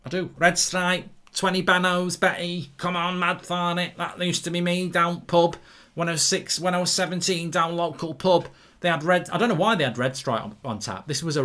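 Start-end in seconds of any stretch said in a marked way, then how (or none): 0.4-8.5 higher-pitched Mancunian lad; Manchester accent. Acted. Reminiscing about teenage pub days, in a Manchester accent. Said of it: "used to speak with a Manchester accent".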